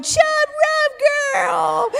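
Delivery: high, cheering chant of the crowd. Retold or imitated. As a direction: cheer her on like a crowd at a party. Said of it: "they're going".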